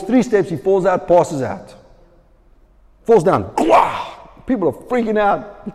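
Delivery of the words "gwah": high, loud comic gasp as he drops; mock collapse cry. Preacher acting out the man fainting with a high comic gasp. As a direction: throw out a sudden gasp of falling.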